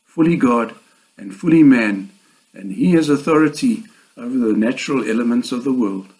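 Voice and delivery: normal talking voice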